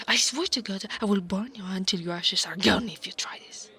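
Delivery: loud, rushed warning; angry threat. Acted. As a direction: threaten them fast and forcefully. Threatens reader not to read.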